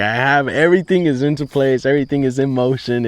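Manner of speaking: everything's finally falling into place; super excited. Retold or imitated. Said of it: "super excited".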